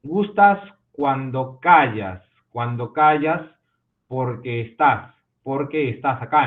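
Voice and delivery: stretches gustas cuando callas out slowly to mark the joined vowels; slow syllable reading. Shown as a demonstration. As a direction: drawl the line so each sinalefa is heard. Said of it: "¿dónde aplico sinalefa?".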